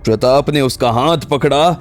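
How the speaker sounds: ordinary talk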